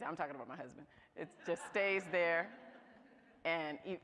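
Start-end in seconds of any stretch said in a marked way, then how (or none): 0.1-0.9 fast throwaway jab; quick aside. Real, not acted. Jab at her husband to the audience, quick and offhand. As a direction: rush the joke under your breath.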